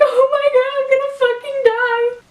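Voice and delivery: high, loud mock wail of a terrified kid; imitating panicked sobbing. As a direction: replay the child's panic, shrieking high. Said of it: "sobbing and being like".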